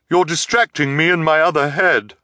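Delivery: high, loud put-on voice; disguised voice. A narrator's reading. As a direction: speak in an assumed freak voice. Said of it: "in her low, disguised voice".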